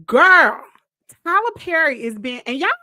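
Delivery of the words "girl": loud call. Real, not acted. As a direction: shout it to the chat. Emphatic call to viewers.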